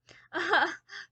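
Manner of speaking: short high burst of laughter; loud laugh. Real, not acted. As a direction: laugh loudly at the callout. Calling out Alyssa.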